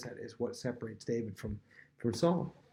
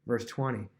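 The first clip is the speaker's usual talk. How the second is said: usual speaking voice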